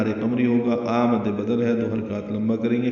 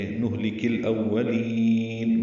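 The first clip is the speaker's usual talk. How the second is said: الْأَوَّلِينَ with its final "lee" held long; lengthened syllable. Shown as a demonstration. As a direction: chant الْأَوَّلِينَ, stretching the ending ِينَ. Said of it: "لام کو لمبا کر دیں گے".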